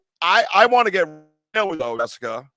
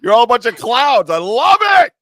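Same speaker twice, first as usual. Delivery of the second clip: high, loud, laughing mock complaint; loud delight; 7 semitones higher, 7 dB louder, slower than usual. Real, not acted. Host overwhelmed by clowning guests, loud amused exasperation. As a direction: shout it through laughter, building higher to the end.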